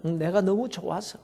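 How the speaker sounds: quiet, understated emotional answer; hushed, moved murmur; soft reply. A narrator's reading. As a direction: drop the voice and answer softly. Tearful pastor answers his wife.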